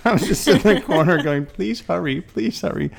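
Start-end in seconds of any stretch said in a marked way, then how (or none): 1.5-2.9 high, hushed mock pleading; mock desperate plea. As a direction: beg under your breath, pitched well up. Retold pleading in slow elevator.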